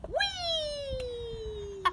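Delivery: long, high drawn-out "whee"; joyful squeal. Pretend. Storyteller ending Alan's story with a gleeful squeal. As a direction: let it glide out high and long.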